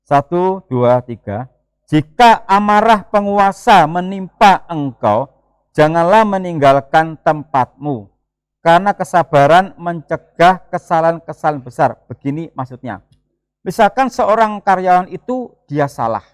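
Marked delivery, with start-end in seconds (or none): 1.9-11.9 slow, loud solemn reading of the verse; scripture reading. Acted. Pastor reading Ecclesiastes aloud to the church, slow and solemn. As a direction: read the Bible verse out to the congregation.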